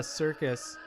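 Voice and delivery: normal talking voice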